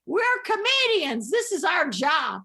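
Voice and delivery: high, defiant mock protest; George Carlin. Comedian answers manager's scolding in the car, mock-indignant protest. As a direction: object indignantly, pitch jumping up.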